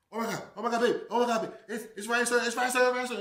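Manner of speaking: high, loud breathless excitement; starstruck excited gasping. Retold or imitated. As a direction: blurt it out, thrilled to spot a celebrity. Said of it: "It's like that go".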